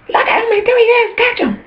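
very high falsetto yell; mock scream. Screaming out the devil's joint smoking, very high mock yell. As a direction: squeal it out like a tattling child. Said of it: "you start screaming".

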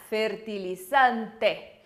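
"Fertilizante" devagar com E final claro; slow model word. Shown as a demonstration. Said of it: "con E al final". ("Fertilizante" lento com E final)

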